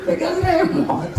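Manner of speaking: high laughing jab; laughing punchline. Acted. Preacher jokes about night prayer with a remote, laughing. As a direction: tease them through a laugh.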